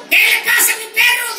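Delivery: high, loud worried cry; alarmed owner shout. Retold or imitated. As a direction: shout in shock at the dog.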